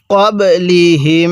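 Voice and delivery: ক্বাবলিহিম slow with a long held final m; measured model reading of ক্বাবলিহিম; slow tajweed recitation. Shown as a demonstration. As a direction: hum out the end of ক্বাবলিহিম. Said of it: "কিভাবে পড়তে হবে?".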